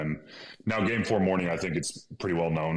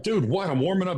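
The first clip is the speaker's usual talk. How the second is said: high, louder irritated "what"; annoyed protest; 11 semitones higher, 4 dB louder than usual. Retold or imitated. Player annoyed at the coach calling him over, high protest. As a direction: brush him off mid warm-up. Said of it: "I'm like".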